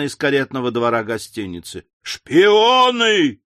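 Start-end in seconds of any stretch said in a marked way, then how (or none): 2.0-3.4 high, loud, drawn-out roar; long high shout of accusation; roaring mob. A narrator's reading. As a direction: bellow the accusation like a crowd. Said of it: "снова заревела толпа".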